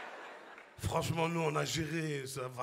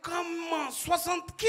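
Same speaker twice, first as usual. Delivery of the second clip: alarmed; 7 semitones higher, slower than usual. Retold or imitated. Alarmed at temperature.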